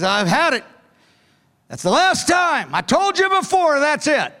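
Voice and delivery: high, exasperated scold, fed up; harsh scolding tone. Retold or imitated. Preacher mimics a harsh God scolding believers, fed up and exasperated. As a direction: snap like a fed-up boss who gives up. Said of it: "you've heard that voice. You know that tone".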